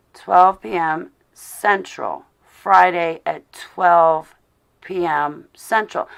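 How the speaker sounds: reading out slowly. Real, not acted. Reading out the meeting time slowly.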